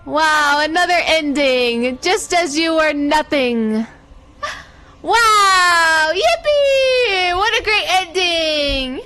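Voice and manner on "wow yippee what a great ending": mock cheer. Sarcastic cheer at bad ending.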